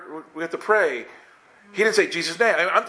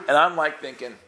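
ordinary talk

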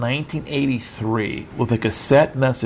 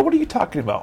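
incredulous outburst; 10 semitones higher, much faster than usual. Retold or imitated. Retold outburst at daughter.

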